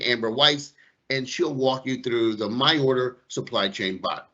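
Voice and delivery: usual speaking voice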